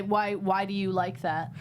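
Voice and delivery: ordinary talk, nothing marked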